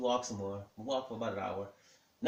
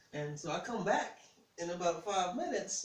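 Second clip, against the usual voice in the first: ordinary delivery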